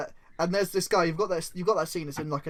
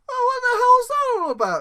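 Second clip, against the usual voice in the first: much higher, fast, squealing bewilderment; squeaky outrage; 7 dB louder, faster than usual. Acted. Employee floating in soup asking the villain, squealing outrage. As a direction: squeal it in baffled panic.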